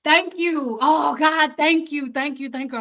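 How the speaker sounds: relieved thanks to God. Retold or imitated. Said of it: "And I'm dancing like".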